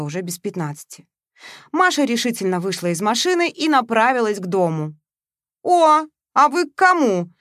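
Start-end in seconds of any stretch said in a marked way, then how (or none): 5.6-7.3 loud, slow, cheeky high voice; brash girl. A narrator's reading. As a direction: pop out at her, brash and nosy. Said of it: "выскочила навстречу ей размалёванная нагловатая девица". (нагловатая девица, громко и нараспев)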